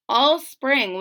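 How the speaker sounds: plain reading voice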